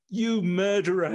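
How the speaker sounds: half-shouting the accusation; retold scream. Prisoner denied his medicine, shouting at the doctor, mock yell. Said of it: "And he started screaming".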